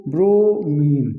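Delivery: Bromine stretched into two long parts; drawn-out term. Shown as a demonstration. Teacher dictating bromine to students, slowly drawn out. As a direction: say bromine slowly, holding both syllables.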